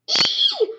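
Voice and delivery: high, loud squeal breaking into a laugh; excited squeal. Acted. Delighted squeal after being caught.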